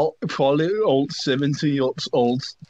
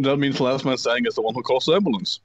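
normal talking voice

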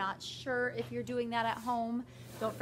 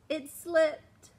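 put-on high, louder kid voice; quick excuse; 6 semitones higher, 6 dB louder than usual. David excusing a bad word, high and loud. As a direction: blurt it out.

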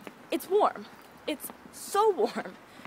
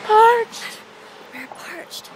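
high, loud croak of thirst; hammed-up parched wail; 10 semitones higher, 12 dB louder, much slower than usual. Vlogger to her friend, melodramatic parched cry.

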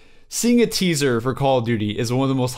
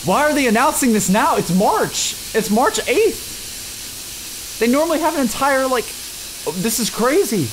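excited disbelief; 11 semitones higher than usual. Real, not acted. Fan baffled at a March reveal, high excited disbelief. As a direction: pitch it way up in disbelief.

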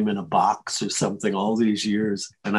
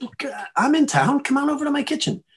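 much higher, fast, chirpy mock voice; makeup man. Retelling the makeup man's call in a high, quick mock voice. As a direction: toss off the invite in a high quick voice.

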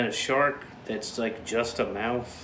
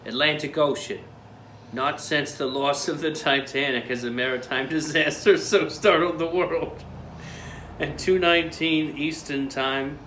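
louder, higher tabloid announcer read; dramatic news read; 4 semitones higher, 3 dB louder than usual. Pretend. Reading tabloid article dramatically.

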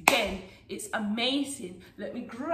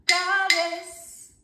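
higher call-out for copying; 5 semitones higher, 4 dB louder than usual. Shown as a demonstration. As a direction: call the name out in time. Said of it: "as you call the name claves".

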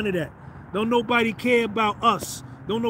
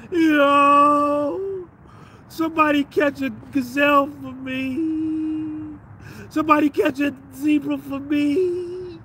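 high, slow crying plea; whining lion; 5 semitones higher, much slower than usual. Pretend. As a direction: beg like a helpless crybaby lion. Weak lion begging.

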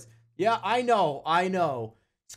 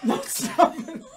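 laughing; 6 semitones higher, slower than usual. Real, not acted. Laughing struggle over baby.

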